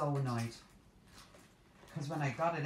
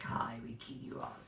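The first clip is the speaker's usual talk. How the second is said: a touch of menacing cookie voice; lightly voiced sinister gingerbread man; evil gingerbread man; 3 semitones lower, 3 dB quieter than usual. Vlogger voicing evil gingerbread man, mild menace. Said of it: "He's like".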